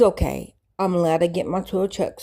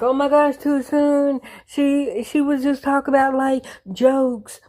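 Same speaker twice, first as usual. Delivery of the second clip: mock gasp; 6 semitones higher than usual. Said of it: "everybody's like".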